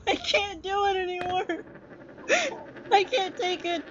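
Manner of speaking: high, tearful whining; whiny despair. Real, not acted. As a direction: complain as if about to cry. At breaking point over game.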